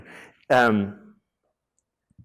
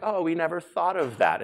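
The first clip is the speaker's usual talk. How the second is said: mock innocence; 8 semitones higher than usual. Mocking the chatbot makers' naive excuse.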